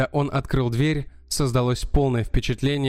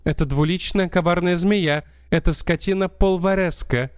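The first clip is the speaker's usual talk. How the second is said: higher, lively countess voice; 4 semitones higher than usual. Acted. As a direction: play an excitable countess, pitched up. Countess denounces her partner.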